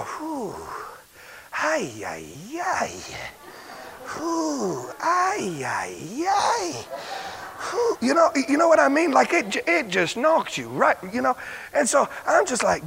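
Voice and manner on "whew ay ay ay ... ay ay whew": breathy whews and soft ay-ays; mild demo of awed groans; overwhelmed sighs. Shown as a demonstration. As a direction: act out being knocked flat. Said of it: "you sit there and go".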